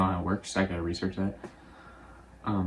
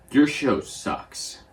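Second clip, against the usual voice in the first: slow, slightly higher mock critic; blunt put-down; 5 semitones higher, 4 dB louder, much slower than usual. Executive popping by to criticize the show, blunt. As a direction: drag it out as a retold insult.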